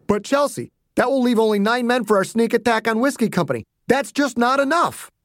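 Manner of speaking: high, urgent protest; general objecting. Acted. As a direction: plead with a child in command. Voices General Ogden.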